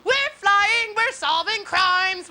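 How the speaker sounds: high, hammed-up cartoon hero voice; mock superhero. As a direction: proclaim heroic deeds with mock grandeur.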